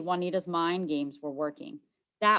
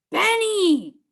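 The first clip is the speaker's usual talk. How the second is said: alarmed shout; 14 semitones higher, 13 dB louder than usual. Acted. Brother spills the secret.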